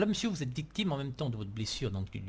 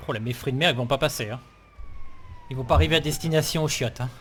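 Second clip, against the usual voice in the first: queasy loud complaint; 8 dB louder than usual. Acted. Carsick in speeding ambulance.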